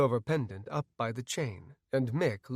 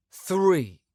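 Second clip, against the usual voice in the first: plain reading voice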